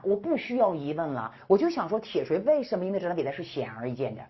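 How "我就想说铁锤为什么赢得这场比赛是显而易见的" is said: usual speaking voice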